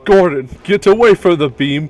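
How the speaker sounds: loud urgent call; shouting a warning. Acted. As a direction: yell it across the room. Calling a player away in game.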